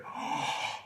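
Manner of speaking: soft, voiceless little gasp; mock scared gasp. Showing the baby what scared sounds like, mock gasp. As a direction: suck in air as if startled.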